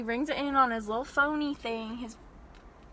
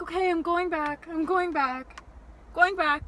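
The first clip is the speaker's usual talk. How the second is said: mimicked old clerk; 5 semitones higher than usual.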